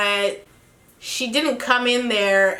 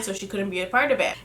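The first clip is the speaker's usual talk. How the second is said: usual speaking voice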